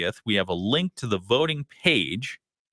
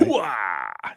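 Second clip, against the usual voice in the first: gung-ho soldier grunt, lightly done; Al Pacino hooah; 2 semitones lower than usual. Retold or imitated. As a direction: bark it like a proud army colonel. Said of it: "That's an Al Pacino thing".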